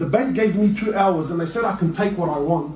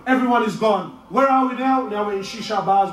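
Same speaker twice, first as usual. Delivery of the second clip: ordinary talk, nothing marked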